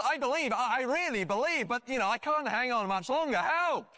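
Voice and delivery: high-pitched, hammed-up desperation; gasped plea. Man losing his grip pleading with God, high mock-desperate gasp. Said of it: "the man gasped".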